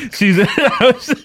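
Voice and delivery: high, breathless laugh through the words. Real, not acted. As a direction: crack up mid-sentence. Laughing while retelling.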